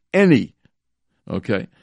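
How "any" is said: any punched high and loud; stress on any. Shown as a demonstration. Rabbi stresses one word of the ruling, any punched. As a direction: hit the word any hard. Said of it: "I'll stress that word".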